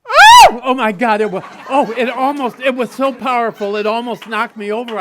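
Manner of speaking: high, loud burst of astonishment; loud, giddy disbelief at catching the ball; amazed shout. Retold or imitated. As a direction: yelp as the ball lands in the glove. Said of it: "I went".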